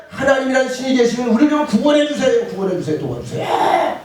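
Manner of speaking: high, loud plea, slowing on the last words; hammed-up desperate begging; acted cry for help. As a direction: call out to God for rescue. Acted cry to God for rescue.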